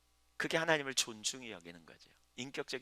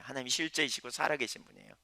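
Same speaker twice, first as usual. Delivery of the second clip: ordinary talk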